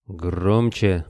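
ordinary delivery, nothing marked

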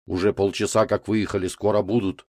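usual speaking voice

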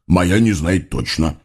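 low, glum hog devil; understated morose mumble; gloomy mutter. A narrator's reading. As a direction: grumble it sullenly. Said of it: "угрюмо пробормотал хряк".

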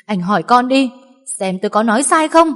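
loud challenge; defiant wife. A narrator's reading. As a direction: dare him to ask the child. (thách thức giận dữ)

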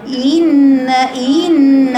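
إن drawn out, the kasra stretched into a ya; overstretched kasra. Shown as a demonstration. Teacher mocks students who overstretch the kasra. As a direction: drag out the vowel of إن. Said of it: "لا، ده تمطيط".

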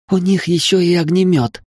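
high, excited voice; near-delighted exclamation. A narrator's reading. Willy spotting the enemy's flamethrower, thrilled and high. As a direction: marvel at it mid-run. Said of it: "почти с восторгом выдохнула Вилли на бегу".

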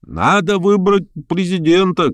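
high, drawn-out call; crying out. A narrator's reading. Guests demanding a chairman, crying out. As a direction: call it across the room in a high voice. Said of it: "крикнули с разных сторон".

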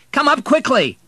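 high, loud urgent summons; shouting. A narrator's reading. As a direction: shout for immediate help. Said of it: "he shouted".

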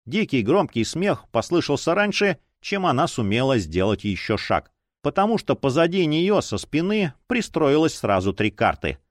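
usual speaking voice

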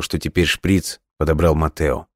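ordinary delivery, nothing marked

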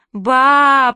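put-on loud, high-pitched childish wail; tearful wail. A narrator's reading. Two trapped girls call Grandma for rescue, tearful wail. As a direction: cry out for Grandma like a sobbing child. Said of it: "плакали мы".

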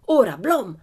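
loud, sharp call to attack; sudden shout. A narrator's reading. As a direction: order the charge. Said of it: "gridò improvvisamente Tuono Carlson". (grido improvviso e forte)

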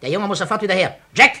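urgent orders. Acted. Gang leader to his boys, loud and urgent. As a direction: send them running now.

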